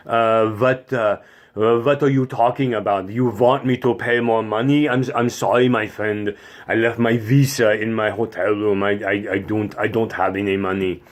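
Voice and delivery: put-on German tourist's accent; hesitant German tourist apologizing in accented English. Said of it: "the German tourists".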